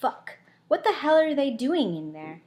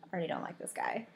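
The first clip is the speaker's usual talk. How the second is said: ordinary delivery, nothing marked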